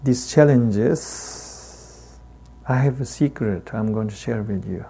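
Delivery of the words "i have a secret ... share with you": ordinary talk, nothing marked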